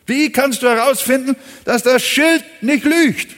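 a preacher challenging doubters to answer. Acted. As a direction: lean in and demand the answer from the congregation. Same question repeated, raised.

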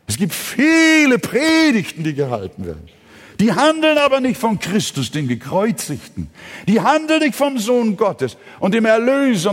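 high, loud preaching with falling line ends; full-force preaching. Real, not acted. Preacher warns congregation against sermons without Christ, impassioned preaching. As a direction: drive key words upward with force.

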